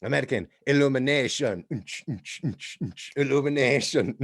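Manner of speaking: put-on young Italian guy. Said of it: "he goes".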